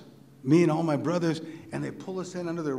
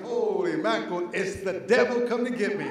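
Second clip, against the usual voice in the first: put-on higher voice of a spooked child; mock terror; 5 semitones higher than usual. Five-year-old seeing the devil beside him, mock terror. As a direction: gasp it out as a scared little boy.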